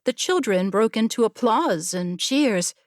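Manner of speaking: ordinary delivery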